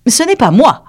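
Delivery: quick, high denial; hasty denial. Acted. The lory to the mouse, hastily denying. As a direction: deny it fast. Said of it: "se hâta d'affirmer le lori".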